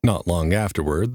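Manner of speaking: ordinary delivery, nothing marked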